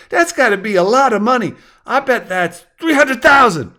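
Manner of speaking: put-on high, wowed kid voice; impressed child. A narrator's reading. Child hearing about a big house, amazed kid voice. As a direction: marvel like a ten-year-old. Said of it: "the same child said".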